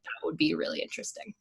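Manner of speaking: hushed, wistful aside to oneself; whispered thought. Retold or imitated. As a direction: quote your own thought in a whisper. Quoting own wistful thought on time travel.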